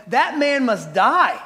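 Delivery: acted royal outrage; outraged decree. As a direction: pass sentence indignantly, stretching each word. David outraged at the injustice.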